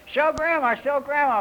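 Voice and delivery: high, singsong parent coaxing. Acted. Parents showing Farquhar off to Grandma, high coaxing. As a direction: eagerly prompt a toddler to perform. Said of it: "and they say".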